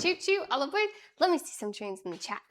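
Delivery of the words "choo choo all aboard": train conductor call. Acted.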